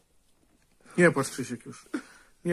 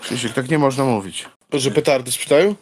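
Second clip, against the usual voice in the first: raised voice, scolding hard; loud scolding; 7 dB louder than usual. Real, not acted. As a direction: tell him off over the fireworks. Heated argument about fireworks. (głośna reprymenda)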